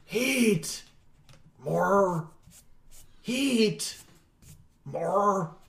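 distant chanting Pokemon cries; muffled Pokemon voices. Acted. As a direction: chant like far-off creatures. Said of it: "muffled Pokemon voices".

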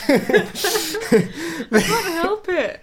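laughing through gasps; can't stop laughing, out of breath; gasping laughter. Real, not acted. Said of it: "Sorry, I'm really gasping".

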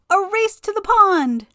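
spring the dare on her; gleeful Wind. A narrator's reading. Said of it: "said Wind".

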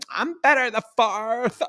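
high, slow mocking voice of Rey. Podcaster mocking Rey's easy wins, in a high, slow, sneering voice. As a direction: sneer it out like a smug know-it-all. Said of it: "she's just like".

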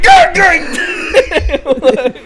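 high, loud startled yell; startled shout. Pretend. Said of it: "I'm like".

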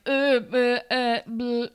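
mock tongue-tied stammer ending in a blurt; nervous stammering. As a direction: imitate someone stuck for words. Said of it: "nervous slash doesn't really wanna".

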